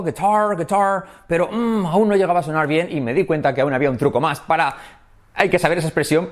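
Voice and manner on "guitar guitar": higher "guitar" with a clear final R; well-pronounced R. Retold or imitated. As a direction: hold the English R at the end of "guitar". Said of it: "pronunciaba bien la R".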